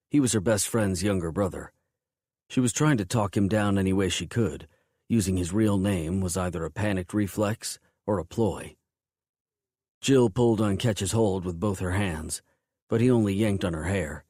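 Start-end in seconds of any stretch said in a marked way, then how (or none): none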